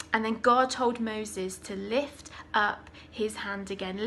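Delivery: ordinary delivery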